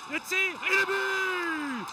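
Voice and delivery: slow, high goal call; excited sports commentary. Real, not acted. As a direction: stretch out the goal shout.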